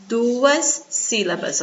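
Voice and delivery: usual speaking voice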